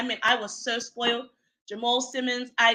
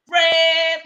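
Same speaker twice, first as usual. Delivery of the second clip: sung-out, high, loud "brave"; held high, ringing exclamation; high brave call; 6 semitones higher, 9 dB louder than usual. Retold or imitated. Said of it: "you're like".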